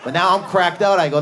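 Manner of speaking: usual speaking voice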